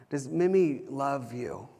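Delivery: gentle question. Retold or imitated. Dad acting out calming his upset son, gentle question. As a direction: ask a small child gently and slowly.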